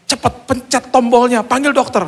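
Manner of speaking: high, panicked-wife yell; urgent yell. Retold or imitated. Wife urging husband to call the doctor, urgent yell. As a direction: cry out urgently for help, pitched high. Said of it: "istri saya teriak".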